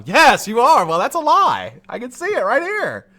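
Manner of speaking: high, loud gotcha voice, catching a liar; loud accusing. Pretend. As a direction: crow it over him, pitch up and loud. Imagined lie detector interrogation.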